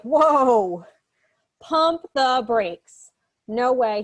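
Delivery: loud, drawn-out mock shock; shocked refusal. Girl stopping a pushy stranger's advance, mock shocked refusal. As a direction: halt him with big, stretched-out words.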